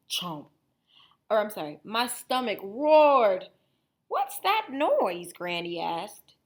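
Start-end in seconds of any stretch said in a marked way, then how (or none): 1.8-3.5 louder, slow rumbling read; roaring. Acted. Wolf's stomach giving him away, slow loud read. 4.1-5.3 put-on high, slow worried old lady; startled Granny. Granny hearing the wolf's stomach, high and slow.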